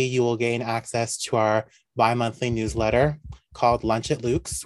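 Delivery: normal talking voice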